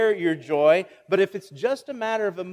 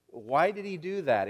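ordinary talk

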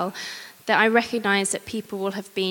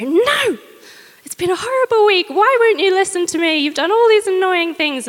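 much higher and louder, an exasperated shout; angry outburst; 11 semitones higher, 8 dB louder than usual. Retold or imitated. Wife snapping at her chatty husband in the car, loud exasperated outburst. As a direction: let it all burst out at once, loud and high. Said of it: "I just turn to him and go".

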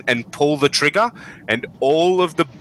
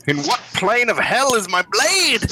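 much higher, comically desperate; exasperated character voice; 11 semitones higher than usual. Acted. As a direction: wail it like a frantic hero. Character searching for lost sword.

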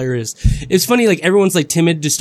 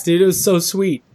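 ordinary talk, nothing marked

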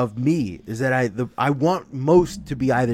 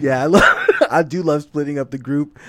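ordinary delivery

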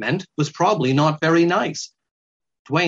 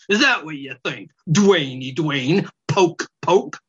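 snide; 4 semitones higher than usual. Acted. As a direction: taunt him like a snide weasel. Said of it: "a tone that was snide".

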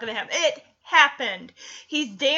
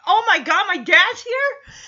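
mock panic; 6 semitones higher, 4 dB louder than usual. Acts out son seeing his dad.

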